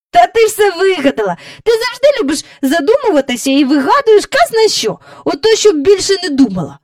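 put-on high, loud child's scolding; indignant retort. A narrator's reading. Child's acted retort in quarrel.

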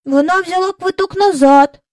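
put-on boy's voice. A narrator's reading. Michael remarking to Jane about the return ticket, high boyish voice. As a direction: pipe up as a young boy.

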